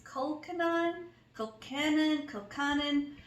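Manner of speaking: Colcannon tried three different ways, unsure of the stress; trying out pronunciations. Shown as a demonstration. Cooking vlogger to viewers, unsure, testing the word. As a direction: sound out Colcannon hesitantly, testing each syllable. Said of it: "I don't know how to say this".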